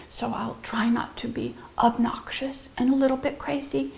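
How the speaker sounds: soft, breathy aside; whisper. Acted. Teacher whispering a promise not to be crazy. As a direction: confide it in a hush.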